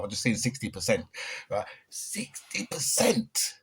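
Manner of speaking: ordinary delivery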